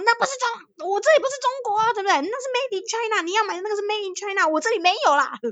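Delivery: high, fast, indignant protest; childhood indignation. Retold or imitated. Retelling arguing with her little cousin, indignant and high. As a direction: insist hotly, pitched well up. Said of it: "然后我就很生气".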